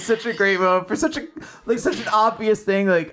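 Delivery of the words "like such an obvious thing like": ordinary talk, nothing marked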